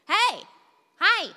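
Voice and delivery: high, loud sing-song hi; toddler's call. Retold or imitated. Son calling hi to shoppers in the aisle. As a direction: greet everyone like an eager toddler. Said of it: "and he was like".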